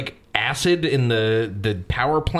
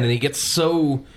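usual speaking voice

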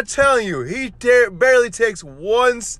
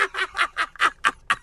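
long high cackle trailing off softer; laughing; 7 semitones higher, 7 dB quieter than usual. Real, not acted. Podcaster cracking up at his own rant, high cackle. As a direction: burst into a high, staccato laugh.

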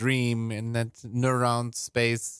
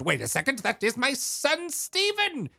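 dawning recognition, pitch soaring on the name; father's sudden realization; 11 semitones higher than usual. Acted. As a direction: climb to a high mock cry. Father suddenly recognizes his son.

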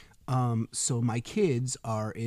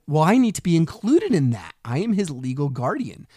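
loud, higher-pitched retold objection; indignant protest; 4 semitones higher, 8 dB louder than usual. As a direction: insist forcefully on being included. Retold protest at being excluded.

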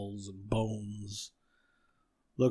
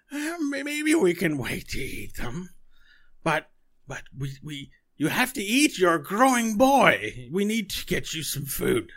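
coaxing witch mother; 8 semitones higher, 6 dB louder than usual. Acted. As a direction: talk the child round like an old hag. Witch mother answers her child.

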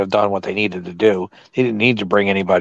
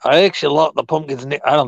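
put-on jokey cockney-style British accent, pitched up; British drifting to Aussie; 4 semitones higher than usual. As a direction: put on a bad British accent and let it wander to Australian. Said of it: "I started off as British and went out to, like, some kinda Aussie".

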